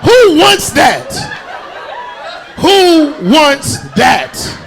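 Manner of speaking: 2 drawn-out laughing yell, high and loud; 3 stretch it out, laughing at the crowd; 1 mock shout. Real, not acted. 4 comic teasing the crowd, slow laughing shout.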